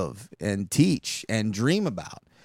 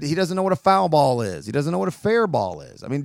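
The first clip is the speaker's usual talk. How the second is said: ordinary talk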